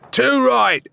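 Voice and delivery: high, loud heckle from the crowd; called out. A narrator's reading. A heckler in the crowd calling out loudly. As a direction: shout it over the tavern noise. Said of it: "called a voice from the crowd".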